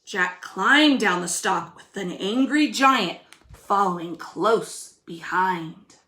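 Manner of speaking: put-on lively storybook reading for kids; reading to children. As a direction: read it like a picture book to little ones. Teacher reads story aloud.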